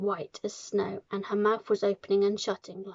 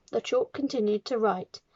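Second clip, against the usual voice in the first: plain reading voice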